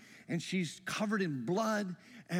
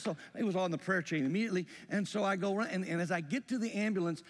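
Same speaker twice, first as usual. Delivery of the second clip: usual speaking voice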